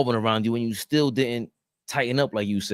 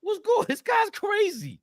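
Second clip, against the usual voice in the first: squeaky high voice; slower than usual. Pretend. Mock high voice.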